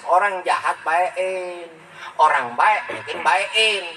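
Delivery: each word drawn out slowly, spelling out the lesson; slow emphatic maxim. Shown as a demonstration. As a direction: say the rule very slowly so it sinks in. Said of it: "Itu kan mesos".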